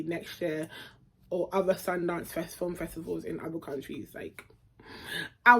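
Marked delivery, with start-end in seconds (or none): none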